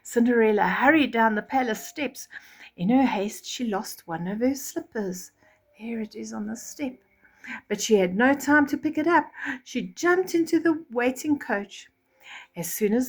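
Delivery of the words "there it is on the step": soft storyteller whisper. A narrator's reading. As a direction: point it out in a hush. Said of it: "unvoiced whisper".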